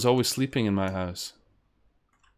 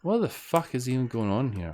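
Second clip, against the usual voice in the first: ordinary talk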